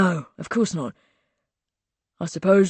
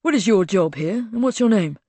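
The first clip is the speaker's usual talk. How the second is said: a little cross; 3 semitones higher than usual. A narrator's reading. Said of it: "He said a little crossly".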